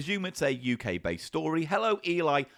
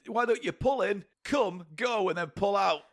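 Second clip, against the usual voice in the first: higher-pitched mock welcome announcement; gas station greeter; 3 semitones higher than usual. Comedian pitching Come and Go to drivers, mock announcer. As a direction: greet customers like a promotional announcer.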